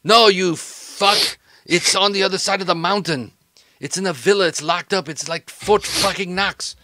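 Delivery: loud burst, then insistent; exasperated henchman. Acted. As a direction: set the boss straight, fed up. Acted quarrel over lost package.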